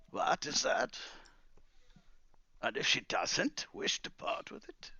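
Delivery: deliberate, leading questions; old wizard, mock questioning. Wizard answering Calamus about Stella's refusal, mock questioning.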